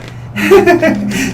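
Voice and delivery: tiny high-pitched giggle; high pixie giggle. Acted. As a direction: giggle like a tiny mischievous fairy. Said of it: "tiny little voice".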